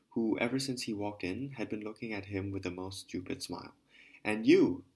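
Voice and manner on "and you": loud furious outburst; shouting. Acted. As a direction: bellow it at the woman. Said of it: "he suddenly shouted at".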